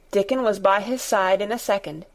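plain reading voice

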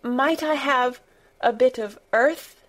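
mildly quavered eager request; quavering, eager. A narrator's reading. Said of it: "In her eagerness".

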